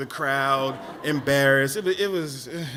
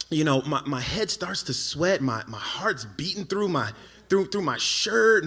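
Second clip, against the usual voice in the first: ordinary talk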